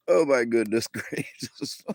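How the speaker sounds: breathless laugh-broken exclamation. Real, not acted. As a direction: still giggling as you say it. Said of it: "I'm laughing so hard".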